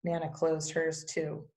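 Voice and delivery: plain reading voice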